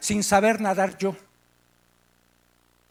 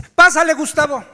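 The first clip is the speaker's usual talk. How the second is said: calling out; 4 semitones higher, 7 dB louder than usual. Retold or imitated. Leader calls speaker up.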